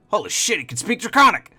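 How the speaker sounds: high, loud astonished kobold; a wounded kobold blurting out in disbelief; shocked kobold. Acted. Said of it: "the one up close that's hurt by fire goes".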